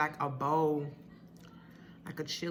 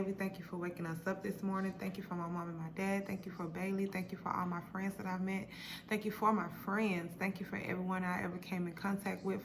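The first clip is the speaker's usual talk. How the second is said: prayer; 6 dB quieter than usual. Acted. Leads a mealtime prayer.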